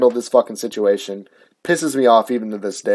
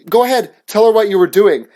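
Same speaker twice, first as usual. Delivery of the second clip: put-on higher-pitched teacher putting a kid on the spot; pointed, raised-pitch mock command; imitated call-out; 7 semitones higher, 4 dB louder than usual. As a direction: mimic a teacher's public prodding. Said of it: "calling me out in front of all these kids".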